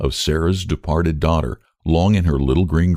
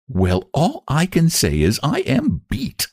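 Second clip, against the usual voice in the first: Lottie voice; 9 semitones higher than usual. A narrator's reading. Lottie astonished.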